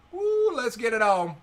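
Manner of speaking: high, loud rallying cry; fired-up cheer; excited whoop. Real, not acted. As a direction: whoop and welcome the fight. Opponent re-raises, player cheers.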